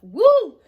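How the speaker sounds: sudden cheering whoop; loud high whoop. Real, not acted. Celebrating being best friends, a loud high whoop. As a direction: throw out a loud high woo.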